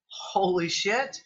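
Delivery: normal talking voice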